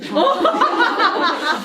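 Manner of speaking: high loud burst of laughter. Real, not acted. After the feminist-voter story, laughing loudly. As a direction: laugh hard at the absurdity.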